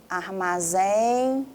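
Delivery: armazém with a long, held ZÉM; drawn-out stressed syllable. Shown as a demonstration. Teacher classifying words aloud, armazém held long on ZÉM. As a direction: drag out the end of armazém.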